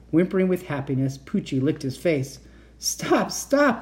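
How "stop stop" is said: high-pitched plea to stop; playful cry. A narrator's reading. Uncle telling licking dog to stop, playful cry. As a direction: cry out, pitched up, being tickled. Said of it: "Uncle Victor cried".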